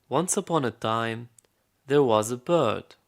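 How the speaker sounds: loud, projected fairy-tale opening; storytelling to a child. Acted. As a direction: announce the tale to a small listener. Storyteller begins tale for boy.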